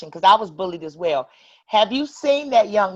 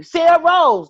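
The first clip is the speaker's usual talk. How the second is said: higher, louder call of her name; 9 semitones higher, 7 dB louder, slower than usual. Real, not acted. Host hands the turn to Sarah Rose, calling out. Host calls on next guest.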